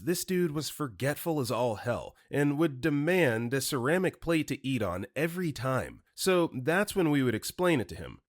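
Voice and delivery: ordinary delivery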